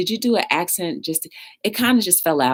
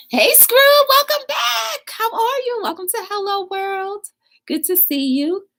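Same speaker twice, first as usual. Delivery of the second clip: excited welcome. Real, not acted. Streamer welcoming back a returning viewer, excited high shout. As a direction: holler a thrilled hello, then ease off.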